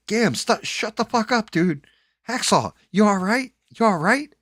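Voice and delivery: high, loud panicked yelling; loud urgent yelling at the mic; alarmed shout. Retold or imitated. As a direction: shout him down, then call out in worry. Friend yells at narrator, checks player.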